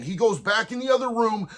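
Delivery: ordinary talk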